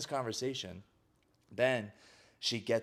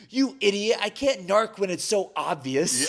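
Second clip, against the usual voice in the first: high, loud comic exasperation; mock quarrel yell; 10 semitones higher, 8 dB louder than usual. Sister answers being called a narc, yelling. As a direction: imitate a sister shouting back at her brother. Retold sibling quarrel with insult.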